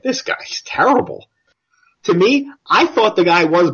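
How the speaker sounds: high, slow put-on British voice; mock British listener. British listener judging the accent, in a high, slow mock British voice. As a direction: sniff it out like a disdainful Brit. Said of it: "they're probably like".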